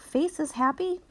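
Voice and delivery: plain reading voice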